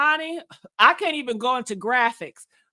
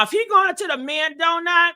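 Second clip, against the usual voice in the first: ordinary delivery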